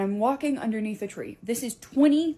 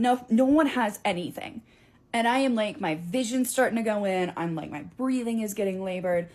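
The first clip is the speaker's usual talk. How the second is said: ordinary talk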